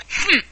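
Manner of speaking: short gruff grunt, pitched high; a loud grunting hm from the Gryphon; a sudden grunt cutting the silence. A narrator's reading. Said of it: "occasional exclamation of".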